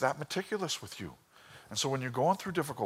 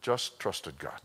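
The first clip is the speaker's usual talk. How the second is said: ordinary delivery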